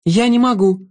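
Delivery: put-on a brave girl standing her ground; young girl Alex. A narrator's reading. Girl cries out.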